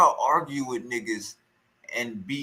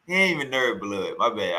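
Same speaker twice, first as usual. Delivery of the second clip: usual speaking voice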